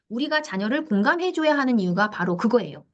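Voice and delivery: ordinary talk, nothing marked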